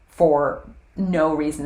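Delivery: ordinary talk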